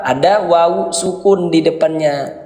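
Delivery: usual speaking voice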